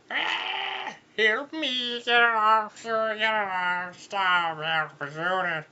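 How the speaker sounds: high, drawn-out panicked yelling; frantic cries for help. Acted. Zam yelling for help while Foyle waits outside, frantic. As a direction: cry out for rescue, stretching the words.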